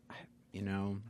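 hushed under the breath; whispering. Real, not acted. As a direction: drop to a near whisper. Whispered aside while answering.

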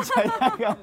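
bursting out laughing, high and loud; laughing correction. Real, not acted. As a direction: laugh through the word 罪悪感. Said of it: "謝罪感じゃないよ".

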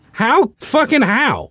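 exasperated. Real, not acted. Exasperated at the stretch.

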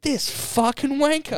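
high, slow put-on mutter; a hammed-up annoyed worker; mock fed-up worker. Imagining the drive-through worker's high put-on scorn. Voicing worker's inner thought.

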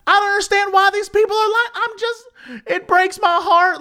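high, wailing mock old woman; upset old lady. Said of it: "And she is furious".

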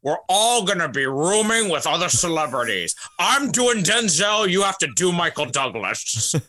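put-on Scottish film-star impression; hammed-up Connery-style star. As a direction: lay down the plan in a mock burr. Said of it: "That's how, that's how she hooked up".

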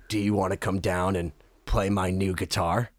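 very low, soft monotone; deep, hushed imitation. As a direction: imitate a rock star's low, quiet invitation. Imitates [name] inviting him.

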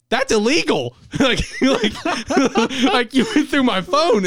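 high, laughing, incredulous; mock-shocked actor cracking up; laughing mock outrage. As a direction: squeak it out in disbelief.

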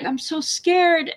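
high, drawn-out mock whimper; lightly acted fearful plea; retold tearful fear. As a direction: imitate a scared, weeping mother, slow and high. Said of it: "You were crying and weeping".

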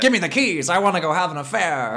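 high-pitched, hammed-up demand; mock movie character. Mimicking the drip character's lines.

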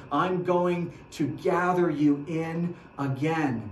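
ordinary talk, nothing marked

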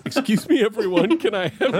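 lightly voiced prim hall monitor, laughing; a hint of a prim hall monitor announcing. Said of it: "[name]'s gonna do that in the hall monitor voice".

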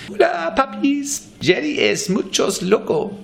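very high, slow Costa Rican dog voice; high, slow Spanish-speaking dog voice with a Costa Rican accent. Retold or imitated. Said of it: "she's got this kind of".

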